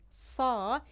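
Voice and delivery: "سا" with the tongue up and lips still; thick Arabic ṣād in "سا"; heavy emphatic S. Shown as a demonstration. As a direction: darken the s of "سا" without pushing the lips out.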